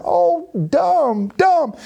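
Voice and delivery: high mock scolding of the foolish king; high teasing rebuke. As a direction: scold him like a silly child, way up high. Preacher mocking the king.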